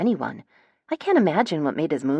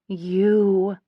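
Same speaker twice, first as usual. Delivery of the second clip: emotionless; 4 semitones higher, 3 dB louder than usual. A narrator's reading. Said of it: "My voice is emotionless".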